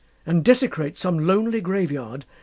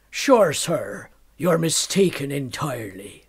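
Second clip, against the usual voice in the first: Irish brogue; 3 semitones lower, slower than usual. Acted. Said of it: "their grumbling Irish voices".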